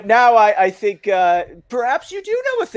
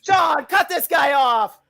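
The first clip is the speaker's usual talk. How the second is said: exasperated order; 7 semitones higher than usual. Acted. Host demands removal.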